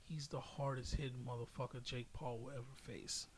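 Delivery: ordinary talk, nothing marked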